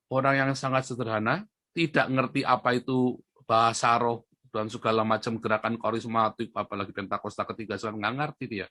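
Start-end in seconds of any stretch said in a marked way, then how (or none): none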